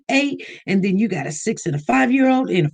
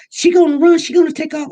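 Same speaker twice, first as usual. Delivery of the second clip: lady calling out; 7 semitones higher, 5 dB louder, faster than usual. Retold or imitated. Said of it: "the lady was like".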